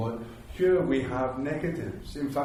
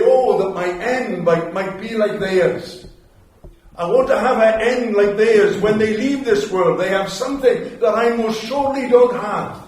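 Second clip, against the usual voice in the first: Balaam's longing plea; 6 semitones higher, 11 dB louder than usual. Retold or imitated. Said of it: "and say".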